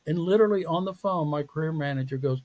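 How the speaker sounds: normal talking voice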